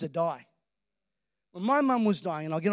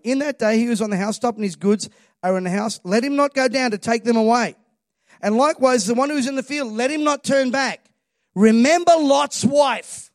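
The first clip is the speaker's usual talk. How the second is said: louder, proclaiming scripture; preached scripture reading; 3 semitones higher, 8 dB louder than usual. Acted. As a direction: declaim the verse to the church. Reads scripture at full force.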